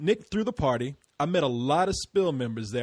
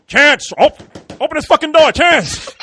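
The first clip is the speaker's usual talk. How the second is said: much louder, higher banging-on-door yell; pretend police shout; 10 semitones higher, 13 dB louder than usual. Host pretending police hunt Chantel, loud yelling. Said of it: "are we gonna hear".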